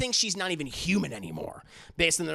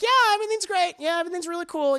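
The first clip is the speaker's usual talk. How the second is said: high, forced cheerful yeah; 14 semitones higher, 4 dB louder, faster than usual. Retold or imitated. Sayaka lies to Madoka that she's fine: high, forced cheer. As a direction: fake being fine, much higher.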